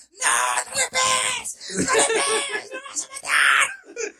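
high cracking yell, pleading; high shouted plea to stop a fight; retold shout. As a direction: scream at them to stop hitting. Retold fight, friends screaming.

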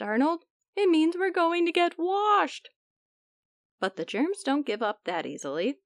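ordinary delivery

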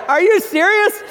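high blurted disbelief. Retold or imitated. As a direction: blurt it out in shock. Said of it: "I'm like".

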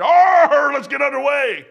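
high, loud rallying yell; excited holler. Retold or imitated. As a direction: re-enact a fired-up sailor's bellow. Re-enacts his excited daily call aboard.

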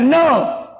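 a sharp, high, loud refusal; curt no. Retold or imitated. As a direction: snap out a flat no. Man curtly refusing the preacher.

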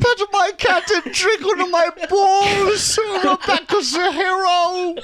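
high, slow goofy squeak; high silly voice. Pretend. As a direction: squeak it out like a cartoon.

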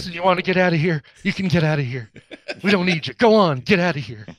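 light Texan accent imitation, shooing away; lightly voiced Texan, playfully shooing someone off; Swayze Texas drawl. Imitating Patrick Swayze shooing off a dog, mild Texan accent. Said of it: "It's a little McConaughey".

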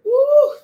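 high loud joyful whoop; church-style whoop of joy; excited whoop. Real, not acted. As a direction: let out a burst of praise. Sudden joyful exclamation.

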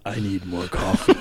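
low, slow gravelly drag; a hint of a gravelly morning voice. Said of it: "gravelly voice".

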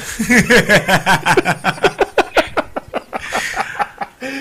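high wheezing laughter; hearty laugh. Real, not acted. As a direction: burst out laughing.

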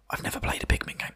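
whispered guilty aside. Real, not acted. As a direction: whisper it as a confession. Confession aside.

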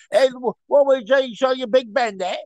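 high, exaggerated Cockney cab driver; thick hammed-up Cockney greeting; severe Cockney. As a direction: do an over-the-top chirpy London cabbie in Cockney. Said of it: "he was a severe Cockney".

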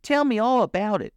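put-on high, excited young listener; high-pitched keen urging; eager request. A narrator's reading. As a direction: beg to hear the whole story. Gobo begs to hear more.

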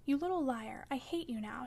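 usual speaking voice

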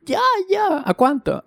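squeaky high, eager ya ya; eager buyer. Retold or imitated. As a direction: jump at the offer, voice shooting way up. Eager buyer asking price.

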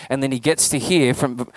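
ordinary talk, nothing marked

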